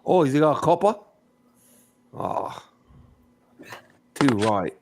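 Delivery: a light put-on Australian accent; mock Australian accent. Teasing a British friend about tea, in a light Australian accent. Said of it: "That's kinda Australian".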